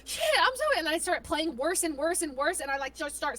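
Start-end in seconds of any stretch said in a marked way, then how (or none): 0.0-0.8 high-pitched mock-flustered apology; nervous apology. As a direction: panic and apologize, voice shooting up. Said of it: "I get so nervous".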